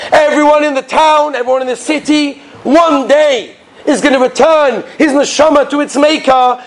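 high, drawn-out wail of doom; fiery maggid preaching. Acted. Maggid warning villagers they will die, wailing sermon. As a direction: warn the whole crowd with stretched-out cries. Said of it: "he gets up and gives Azad Drasha".

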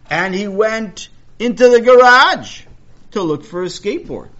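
loud, high, stretched-out narration; suspenseful storytelling at full volume; dramatic build-up. A narrator's reading.

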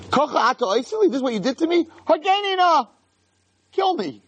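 high-pitched, aggrieved acted plea; despairing protest. Moshe quitting before God, in high despairing protest.

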